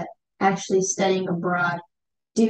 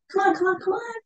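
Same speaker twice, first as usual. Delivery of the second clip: coaxing plea; 12 semitones higher, faster than usual. Retold or imitated. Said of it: "be like".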